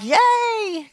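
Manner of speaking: high, bright squeal of delight; excited cheer. Retold or imitated. Leader cheering at people crying in prayer, high squeal. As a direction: cheer it like great news. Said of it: "I'm like".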